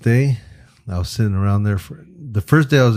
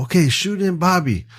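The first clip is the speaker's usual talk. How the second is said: much higher voiced crew order; crew calling out; 10 semitones higher than usual. Crew sending Bobby into the shoot, calling out. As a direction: call the next star in, high-pitched.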